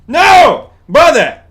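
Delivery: high loud outraged shout. Real, not acted. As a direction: yell out in protest. Streamer reacting in game.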